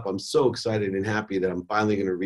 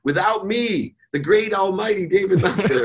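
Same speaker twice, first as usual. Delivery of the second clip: mock arrogant boast; 11 semitones higher, 4 dB louder than usual. Mocking his own arrogant ego.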